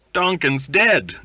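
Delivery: high, loud, slow jeer; mock announcement. A narrator's reading. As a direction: call it out as a loud joke. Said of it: "guffawed someone".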